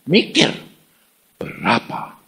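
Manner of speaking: usual speaking voice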